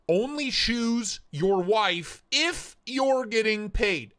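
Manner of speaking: slow, high, word-by-word reading; deliberate mock reading. Shown as a demonstration. Streamer rereads a voice-to-text donation as gibberish, deliberate mocking. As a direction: spell out each word to show the nonsense.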